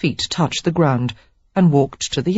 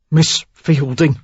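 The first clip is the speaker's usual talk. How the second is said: slow, forced words; strained effort; 3 dB louder, slower than usual. A narrator's reading. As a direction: push the name out with effort. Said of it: "he said with great effort".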